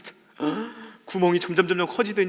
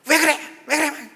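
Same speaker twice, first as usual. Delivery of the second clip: much higher and louder, snapping; quarrelling family member; 10 semitones higher, 6 dB louder than usual. Retold or imitated. Family member snaps during last night's fight, higher and louder.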